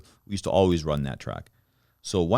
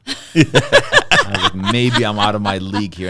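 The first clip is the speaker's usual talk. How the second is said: laughing through the line, louder; 7 dB louder, slower than usual. Real, not acted. Boxer laughing as he recalls backing down to the coach, laughing. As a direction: chuckle while admitting defeat. Laughing at his own retreat.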